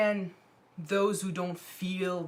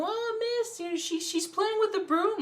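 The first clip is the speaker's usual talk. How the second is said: whiny tattletale voice. Retold or imitated. Bully tattling to the driver.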